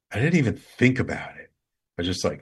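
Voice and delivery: ordinary delivery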